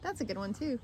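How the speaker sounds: ordinary talk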